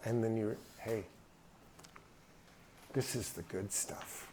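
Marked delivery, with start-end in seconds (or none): 2.9-4.3 soft echo of dad. Retold or imitated. Echoing dad's words softly.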